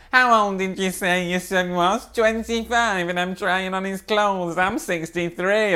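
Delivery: high, drawn-out put-on dad voice; stretched, high-pitched mock bewilderment; incredulous dad. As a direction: imitate a baffled dad protesting. Voices Dad's incredulous protest.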